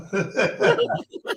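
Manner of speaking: bursting high laugh. Real, not acted. Laughing after his impression.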